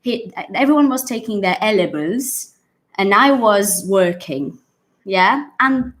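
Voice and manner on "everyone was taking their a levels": A LEVELS said as one word, "ELL-uh-bulz"; imitated mispronunciation. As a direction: copy the learner's garbled "ELLerbulls" for A LEVELS. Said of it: "He said it like this".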